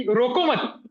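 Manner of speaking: रोको मत as one unit; रोको मत together, break after मत; मत joined to रोको. Shown as a demonstration. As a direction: run रोको straight into मत. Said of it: "पूरा अर्थ ही बदल जाता है".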